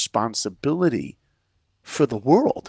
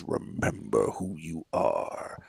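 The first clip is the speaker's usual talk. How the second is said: slow, hushed whisper from the sky; ghostly whisper; 8 semitones lower, 8 dB quieter, slower than usual. Retold or imitated. Mufasa speaking to Simba from the stars in a whisper. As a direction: breathe it like a voice from the stars.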